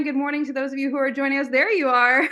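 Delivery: ordinary talk, nothing marked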